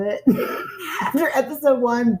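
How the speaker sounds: high giggling confession; laughing. Real, not acted. Host giggling about breaking her own promise. Confessing she came back.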